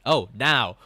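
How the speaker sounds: mock comeback. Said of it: "And I'll be like".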